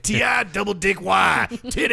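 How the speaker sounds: shouted spelling. Pretend. Spelling nickname with a joke.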